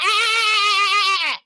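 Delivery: high, long-held "Aaah!"; drawn-out high-pitched holler; defiant mock yell. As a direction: stretch out one high cry. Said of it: "be like".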